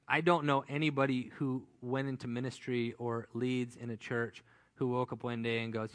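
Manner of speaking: usual speaking voice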